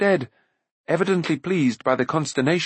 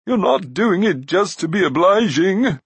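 raised, loud, wavering voice; anxious giant; 6 semitones higher, 5 dB louder than usual. A narrator's reading. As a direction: press worriedly for the truth. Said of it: "he asked in an anxious voice".

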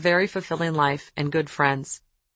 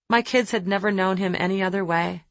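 usual speaking voice

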